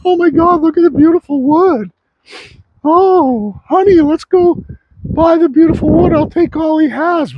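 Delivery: high, excited gushing customer voice; mock gushing buyer. Sawmill owner mocking craft buyers in a high gushing voice. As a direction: squeal over the wood like a thrilled shopper.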